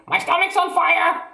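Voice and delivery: put-on very high, loud old woman's shriek; panicked shout. A narrator's reading. Grandma shrieking for help, very high and loud.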